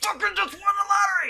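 high, hammed-up yell; student overacting a lottery win, screaming; screaming at the top. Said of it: "screaming at the top".

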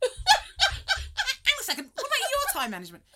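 imitating an indignant friend, high and loud; mock challenge. Friend mimics Maureen turning on him about time management, mock indignant. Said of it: "she turned to me and said".

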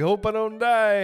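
laughing mock dread. Jokes about breath-holding fear.

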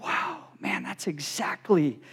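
hushed whisper of amazement; whispered awe. Retold or imitated. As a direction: breathe it out in awe. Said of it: "they were like".

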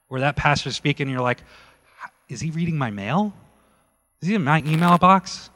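loud, shocked exclamation; shocked churchgoer exclaiming loudly to his brother. Retold or imitated. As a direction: blurt it out loud in amazement.

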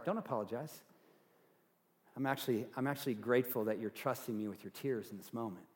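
quiet, tender comfort; soft reassurance. Shown as a demonstration. Pastor modelling comfort for a friend in tears. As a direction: reassure them gently, close and soft. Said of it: "if you're with someone".

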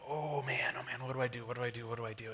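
softly repeated panicky self-talk; mock panic. Acted panicked thoughts beside the bear.

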